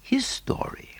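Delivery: breathy narrator's whisper, hushed. A narrator's reading. Narrator hushes the listener with a whisper. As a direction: lean in and whisper the words. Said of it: "whispered aside".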